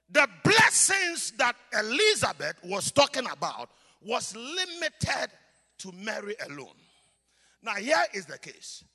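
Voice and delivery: usual speaking voice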